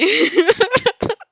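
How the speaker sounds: real burst of loud, high laughter. Real, not acted. Narrator cracking up loudly at misnaming Dabi. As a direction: let out a big laugh.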